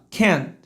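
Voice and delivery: "can't" with the t almost silent; barely sounded t. Shown as a demonstration. Teacher asking them to notice, "can't" with hidden t. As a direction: hold back the t of "can't". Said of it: "Quase não sai o som do T".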